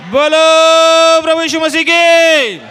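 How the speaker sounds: loud, high, drawn-out acclamation; rallying shout. Real, not acted. Preacher leading congregation in praise to Jesus, rallying shout. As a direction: shout it out long for the whole crowd.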